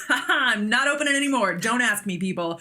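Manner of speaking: giddy, laughing announcement to everyone; gleeful laugh. Retold or imitated. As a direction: crow it out with a laugh.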